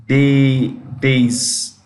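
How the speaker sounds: Day first, then days with the final z sound; demonstrated pronunciation. Shown as a demonstration. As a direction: model Day, days in separate pieces. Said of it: "How do you pronounce it?".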